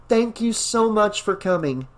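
high, light woman's voice, excited to see her dog; young woman voice. Acted. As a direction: voice Rachel, happy and relieved. Narrator voicing Rachel.

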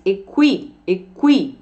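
"equi-" cut off before "-ment"; "equi-" alone, repeated as a drill; word in parts. Shown as a demonstration. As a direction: say only the first part, "equi-", stressing "kwi". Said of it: "Agora junto os dois".

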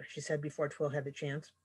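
usual speaking voice

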